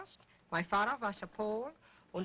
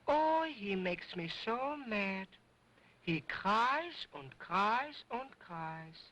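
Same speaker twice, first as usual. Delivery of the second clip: slow whiny complaint; slower than usual. Acted. Dummy complains about baby brother.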